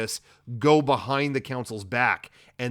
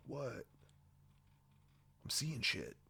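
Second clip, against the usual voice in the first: hushed startled mutter; 17 dB quieter than usual. Retold or imitated. Sifo-Dyas startled by vision.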